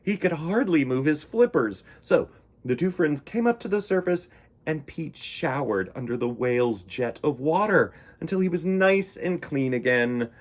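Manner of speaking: plain reading voice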